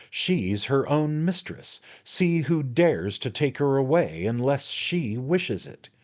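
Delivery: brisk, high, slow-paced defiance; a lightly voiced rake standing his ground; a hint of a cocky adventurer; Jingle, defiant. Jingle speaks in the story.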